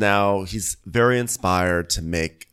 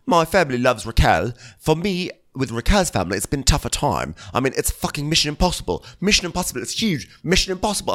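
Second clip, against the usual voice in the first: imitating James; 7 semitones higher than usual. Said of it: "so he's like".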